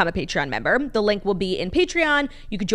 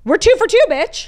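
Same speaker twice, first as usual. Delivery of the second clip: much higher and louder, crowing; triumphant boast; 12 semitones higher, 8 dB louder than usual. Real, not acted. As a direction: brag it like a win. Boasting about keeping the schedule.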